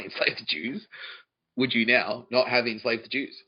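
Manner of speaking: ordinary talk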